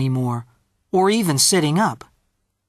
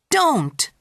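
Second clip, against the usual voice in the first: put-on high-pitched little-boy command; child's sharp protest; 10 semitones higher than usual. A narrator's reading. Peter grabbing little brother's arm, cross protest. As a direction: stop him with a high exclamation.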